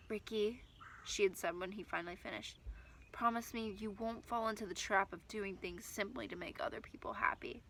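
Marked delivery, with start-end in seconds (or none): 0.1-0.6 hushed whisper, much softer. Acted. Mom speaking softly at table. 3.1-7.6 hushed whisper, much softer. Acted. Mom's quiet plea to son.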